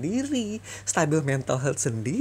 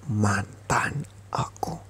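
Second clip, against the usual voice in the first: slow, breathy whisper; 7 semitones lower, much slower than usual. Acted. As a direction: hiss it low and slow. Berbisik pada mantan saat putus. (bisikan pelan dan lambat)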